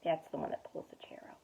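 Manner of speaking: quick breathy whisper to the listener; whispered aside. Real, not acted. Parent whispering to the child about the picture, hushed. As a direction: point it out under your breath.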